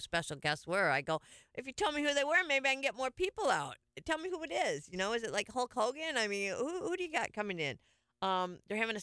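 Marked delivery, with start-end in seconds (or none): 1.5-7.8 higher-pitched, animated badgering; mock pleading. Host retelling a plea for guest names, mock pleading. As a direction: press them for the secret with playful exasperation.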